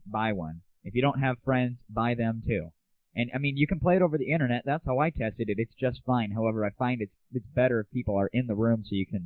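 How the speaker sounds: ordinary talk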